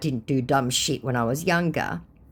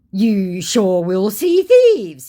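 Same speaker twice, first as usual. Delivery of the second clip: slow, loud, higher put-on scammer voice; mock scammer retort; 5 semitones higher, 7 dB louder, much slower than usual. Scammer retorting to her about thieves, slow and mocking. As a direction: stretch every word, hammed-up.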